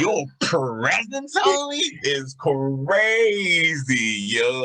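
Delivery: high, slow, drawn-out amazement; hyped-up praise. Retold or imitated. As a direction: stretch the key words in excited awe. Teacher hyping up the boy's performance.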